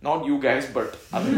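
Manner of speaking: usual speaking voice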